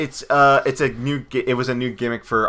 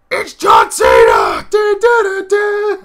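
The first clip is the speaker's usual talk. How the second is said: loud, slow announcer yell then high sung du du; hammed-up ring intro; announcer yell into theme; 7 dB louder, slower than usual. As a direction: boom the name, then sing the theme. Announcer call plus theme.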